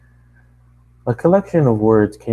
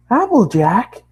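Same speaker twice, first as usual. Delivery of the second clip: sharp high call; 13 semitones higher, 5 dB louder, slower than usual. Acted. Pony scolds friend.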